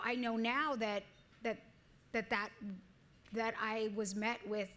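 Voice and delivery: ordinary talk, nothing marked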